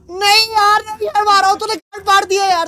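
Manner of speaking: high loud whine; whining despair. Real, not acted. Gamer just killed by the ghost, whining despair. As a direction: moan at losing.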